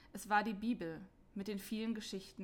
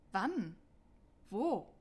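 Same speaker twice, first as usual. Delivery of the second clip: louder, quick surprised questions; astonished; 4 dB louder than usual. Acted. Martin asks the voice where, astonished. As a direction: ask in sudden astonishment. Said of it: "fragte Martin erstaunt".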